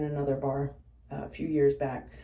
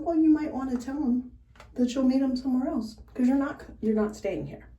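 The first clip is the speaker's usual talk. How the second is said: stern warning; 6 semitones higher, 3 dB louder than usual. Retold or imitated. Bartender telling off a customer.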